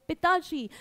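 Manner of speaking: normal talking voice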